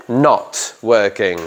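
NOT drawn out slowly and hit hard; stress on not. Shown as a demonstration. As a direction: lean long on not. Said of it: "not, not, not". (acento en NOT, lento)

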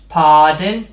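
ordinary delivery

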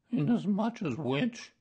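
high-pitched mournful character voice; sad thought. A narrator's reading. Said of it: "he thought sadly to himself".

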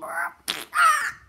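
sudden shrill "Ah!" shout; excited high yell. Real, not acted. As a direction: yell high and loud with glee. Excited yell at game start.

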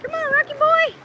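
loud high call to Rocky; high coaxing call. Real, not acted. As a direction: call the pup in, voice way up. Calling the dog Rocky.

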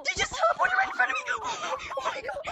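gasping, high shrieking surprise; scared horror game streamer. Real, not acted. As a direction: shriek with laughing shock. Ghost teleports in front, gasps.

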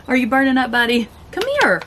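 high sing-song to the dog; pet talk. Real, not acted. Calling the dog over.

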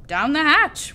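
hearty group answer; crew shout. A narrator's reading. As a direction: shout back to the captain. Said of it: "we all shouted".

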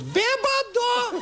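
very high, drawn-out falsetto; mysterious taunting voice. Acted. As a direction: stretch the squeaky taunt out slowly. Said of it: "The voice goes".

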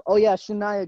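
ordinary delivery

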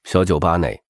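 ordinary talk

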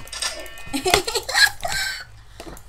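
very high, squealing laugh; high-pitched laughing fit; high giggle. Real, not acted. High cackle after losing sheep.

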